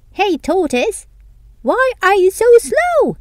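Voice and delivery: high, loud mocking hare voice; teasing hare. Hare mocking the tortoise, high and teasing. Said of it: "asked the hare".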